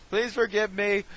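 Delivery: loud, high mock plea; mock pleading. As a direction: beg forgiveness with hammed-up force. Mock apology, repeated as louder plea.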